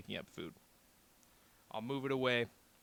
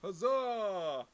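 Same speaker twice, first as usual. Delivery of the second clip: cheering toast; 8 semitones higher, 7 dB louder than usual. Real, not acted.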